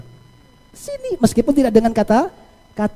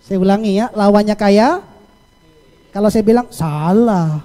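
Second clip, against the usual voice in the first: usual speaking voice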